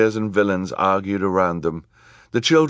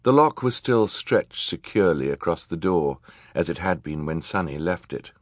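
plain reading voice

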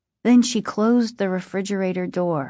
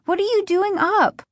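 high-pitched, jolted question; startled questioning; 12 semitones higher than usual. A narrator's reading. Sister finding her brother in the kitchen at night, startled questioning. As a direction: ask it high, still rattled by the fright.

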